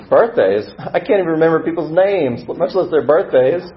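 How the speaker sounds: high, loud, incredulous comeback; incredulous stranger. Acted. New friend confessing he forgets even names, incredulous. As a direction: top their confession with your own, exclaiming. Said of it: "the voice is walking toward you now".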